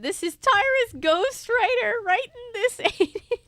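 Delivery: loud laughing, high and incredulous; laughing disbelief. Real, not acted. Reader mocks the fanfic's writer, laughing loudly. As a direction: crack up at how bad it is.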